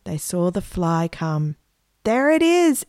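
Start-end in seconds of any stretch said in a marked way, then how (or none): 2.0-2.9 high, thrilled cry of discovery; excited exclamation. A narrator's reading. Margaret spotting the fly at last, excited exclamation. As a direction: exclaim it with a leap in pitch. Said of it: "exclaimed Margaret".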